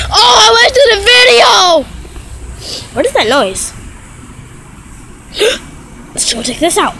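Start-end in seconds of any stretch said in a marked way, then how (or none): 0.1-2.0 high, wailing complaint; loud dismay. Real, not acted. Vlogger realising the video is wasted, dismayed wail. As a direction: wail it out in exasperation.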